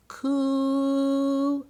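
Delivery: "Coo" drawn out long and high; pronounced slowly. Shown as a demonstration. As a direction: say "Coo" slowly, stretching the vowel. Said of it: "You say it like this".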